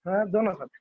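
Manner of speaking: usual speaking voice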